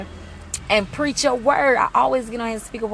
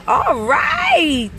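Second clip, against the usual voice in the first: excited cheer; 13 semitones higher than usual. Real, not acted. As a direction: whoop it out. Cheering a viewer.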